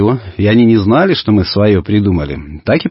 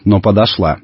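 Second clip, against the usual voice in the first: ordinary delivery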